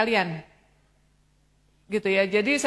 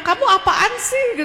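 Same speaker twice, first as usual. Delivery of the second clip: high, loud, slow mock scold with a laugh; mock scolding parent; 12 semitones higher, 6 dB louder than usual. Mother scolding her child who talks about dating, hammed-up high and loud. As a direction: play the dismissive parent, half laughing. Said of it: "sebelum dikritik sama orang tuanya".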